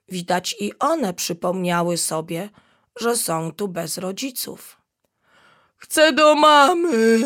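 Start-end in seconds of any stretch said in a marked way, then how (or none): 5.8-7.2 high, very loud, slow bawling; little hippo bawling for his mum, stamping; wailing yell. Acted. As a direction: howl for mummy like a tantrum. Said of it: "rozwrzeszczał się".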